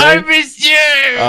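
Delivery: slow, high wail; hammed-up lament; dramatic sobbing. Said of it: "you're so dramatic".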